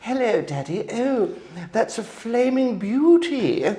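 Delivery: very high, slow, hammed-up posh English child; posh little boy. Five-year-old self gushing to Daddy, high posh English. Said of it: "It's very posh, and I sounded like".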